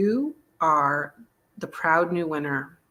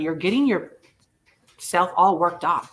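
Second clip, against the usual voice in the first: usual speaking voice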